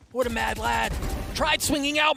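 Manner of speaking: loud, high hype shouting; esports caster. Real, not acted. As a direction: scream the multi-kill. Hype call after triple kill.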